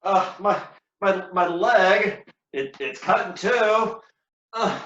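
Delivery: wounded and bleeding. Acted. Cassio still bleeding out on the ground, stumbling pained voice. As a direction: stammer through agony.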